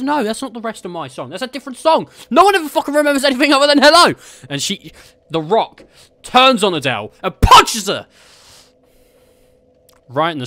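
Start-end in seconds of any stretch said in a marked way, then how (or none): 0.0-4.1 high, fast, building to a loud rant. Acted. [name] ranting at [name] about her song, high and loud. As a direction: fire it off faster and higher. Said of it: "She goes".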